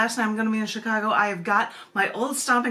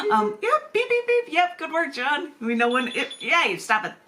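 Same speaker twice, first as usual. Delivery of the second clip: teasing; 8 semitones higher than usual. Pretend. Teasing husband John over beeping.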